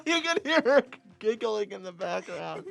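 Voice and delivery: laughing. Real, not acted. Cohost losing it laughing.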